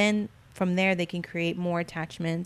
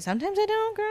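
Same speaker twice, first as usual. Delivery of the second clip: high-pitched admission. Real, not acted. Admitting with high exclamation.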